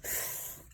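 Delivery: "f." as a soft voiceless hiss through the teeth; letter sound f. Shown as a demonstration. Teacher modeling F for students, a breathy voiceless "f". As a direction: breathe out an unvoiced "f". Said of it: "F says".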